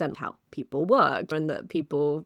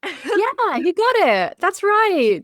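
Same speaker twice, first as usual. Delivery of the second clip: loud, high-pitched playful cheer; mock agreement; 11 dB louder, much slower than usual. Co-host jokingly confirming the cheating accusation, exuberant mock agreement. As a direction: agree with exaggerated gusto, way up high.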